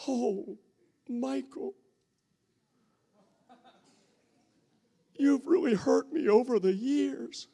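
high, lightly voiced wounded wife; wife telling her husband he hurt her, high; mock hurt wife.

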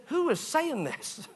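half-joking shock at a voice out of nowhere; startled high question. Retold or imitated. Stunned at an inner voice, asking who speaks. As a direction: rise high and slow in disbelief. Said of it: "I said".

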